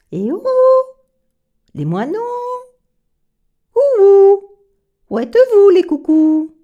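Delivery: loud high call into the trees. Acted. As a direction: shout up high to find the birds. Calling out to birds. (appel fort et aigu)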